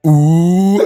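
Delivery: long, high, loud "ooh"; a whole classroom's drawn-out mock "ooh"; taunting class "ooh". Class reacts to a pupil's red card, taunting "ooh". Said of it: "the whole class goes like".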